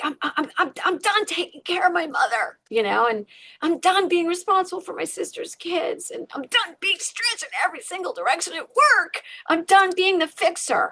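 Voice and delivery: high-pitched exasperated rant of the inner voice; inner tirade. Retold or imitated. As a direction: blurt out every burden you're fed up with. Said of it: "the tirade in your head".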